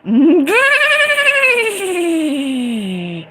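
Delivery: high, drawn-out squealing laugh; real delighted laughter. Real, not acted.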